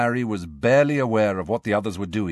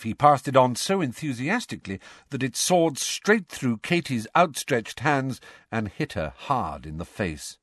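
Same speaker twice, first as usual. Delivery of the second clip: ordinary talk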